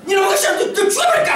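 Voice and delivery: high, mock furious threat; exasperated mother. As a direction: blow up at a sulking child.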